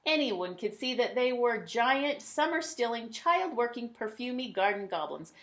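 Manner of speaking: ordinary delivery, nothing marked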